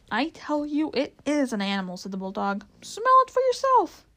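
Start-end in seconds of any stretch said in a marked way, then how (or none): 0.1-2.0 higher, insistent bulldog character; emphatic insistence. A narrator's reading. Bulldog argues back. 2.8-4.0 high, loud bulldog outburst; loud high-pitched character yell; exasperated shout. A narrator's reading. Bulldog challenges the others.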